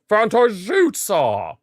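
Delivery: excited call breaking high; voice crack. Real, not acted. Commentating his own shot with a cracking excited voice. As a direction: rise with the shot until the voice cracks. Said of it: "Bit of a voice crack there".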